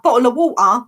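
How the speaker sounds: British glottal stops in "bottle of water"; dropped consonants. Shown as a demonstration. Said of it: "like, no continent, consonants".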